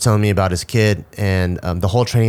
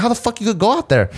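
far higher, stunned and disbelieving; incredulous question; 11 semitones higher than usual. Retold or imitated. Retelling his shock at the Navy seal's answer, high incredulous question. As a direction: ask it like the idea is insane. Reacting to the ten-month-old swimming.

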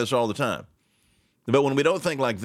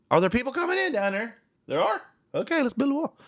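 very high, hammed-up surprise; mock clueless president; 12 semitones higher, faster than usual. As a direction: play a leader feigning sudden discovery. Acts out clueless president's sudden discovery.

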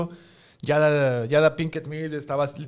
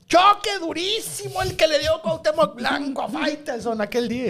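mock announcer; 10 semitones higher than usual. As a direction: hype it like a sports caster. Mock sports announcer headline.

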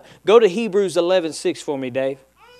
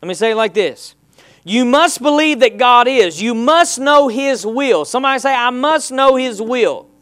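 full-force preaching; 5 semitones higher, 5 dB louder than usual. Acted. Pastor driving home faith to congregation, preaching at full force.

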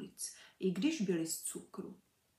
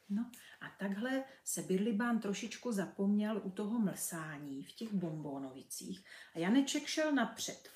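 plain reading voice

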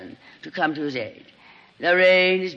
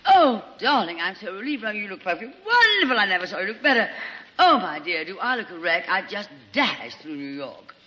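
gushing Lorraine. Acted. Lorraine greeting injured Sheridan, gushing and theatrical. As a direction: fawn over him like a melodramatic diva.

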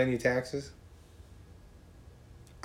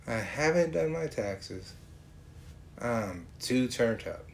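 slow mocking quote; 5 dB quieter, slower than usual. Mocking the lyric slowly.

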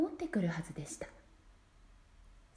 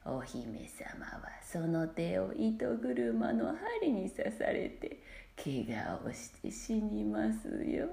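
slow, low, hissing whispered curse; spiteful witch's curse; 3 semitones lower, 4 dB quieter, slower than usual. A narrator's reading. Old witch cursing the baby princess, slow spiteful whisper. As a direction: breathe the curse out slowly with hate. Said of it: "さも忌々しそうに首を振りながら".

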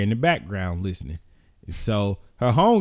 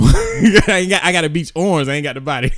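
laughing; 5 semitones higher, 6 dB louder than usual. Real, not acted. Laughing at own joke.

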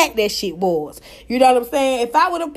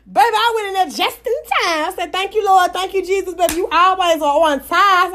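excited thanks; 6 semitones higher than usual. Real, not acted. Thanking God.

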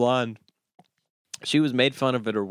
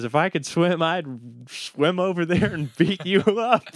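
laughing threat; 6 semitones higher than usual. Acted. Blonde driver threatens the rower, laughing through it. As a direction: try to finish the insult but crack up.

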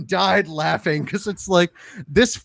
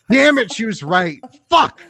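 exasperated outburst, higher and louder at both ends; mad; 4 semitones higher, 5 dB louder than usual. Real, not acted. Husband admitting his wife was right, mad. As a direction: curse in frustration, ending on a loud high burst. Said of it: "I'm mad 'cause she's right".

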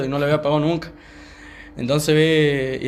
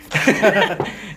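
higher-pitched burst of laughter; 5 semitones higher than usual. Real, not acted. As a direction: burst out laughing mid-story. Laughs while retelling the hitting.

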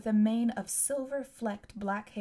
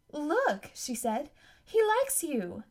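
put-on girl voice; 6 semitones higher, slower than usual. Excited girl voice.

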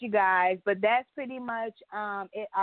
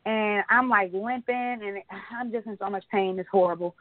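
usual speaking voice

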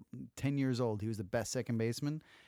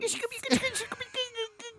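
squeaky mocking laugh. Teasing laugh, high put-on.

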